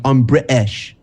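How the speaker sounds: mock British, glottal stop in "British"; "British" as two bits, Bri-ish; dropped-middle British. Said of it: "there's no I, it's just British".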